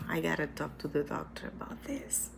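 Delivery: whispered aside, fast; whispering. Real, not acted. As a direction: whisper it quickly to yourself. Drops to an aside about doctor.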